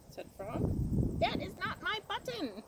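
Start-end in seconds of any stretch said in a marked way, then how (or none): 1.2-2.6 much higher, mock shout. A narrator's reading. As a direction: shout it up high in storybook frustration. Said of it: "shouted Toad".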